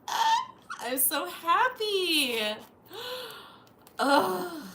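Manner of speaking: loud, slow happy cry; excited. Real, not acted. Happy with her friend's present, loud slow gush. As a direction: gush with joy. Gift delight.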